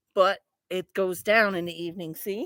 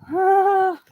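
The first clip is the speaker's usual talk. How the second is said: usual speaking voice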